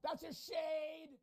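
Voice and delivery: high, drawn-out shout; indignant high-pitched holler; protesting shout. Real, not acted. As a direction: yell it high, stretching out SHADE. Mock outrage at a video answer.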